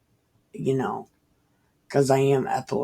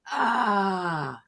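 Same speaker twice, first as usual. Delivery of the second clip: demon cry; 6 semitones higher than usual. Retold or imitated. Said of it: "And demon was like".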